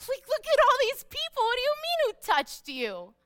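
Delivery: high-pitched mock disbelief, dropping at the end; incredulous disciples. Disciples question Jesus amid the crowd, high-pitched and incredulous. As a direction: protest as if the question is absurd. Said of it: "The disciples are like".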